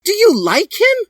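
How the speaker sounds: shrieked very high and loud; shouting. Acted. Dally catching Hiei after seeing Sasuke, in a very high loud shriek. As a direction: shriek it in outrage. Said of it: "shouted Dally".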